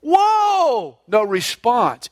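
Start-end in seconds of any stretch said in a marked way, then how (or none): 0.0-1.0 panicked shout. Retold or imitated. Rider screaming at his horse at the cliff edge, desperate shout. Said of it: "In his panic".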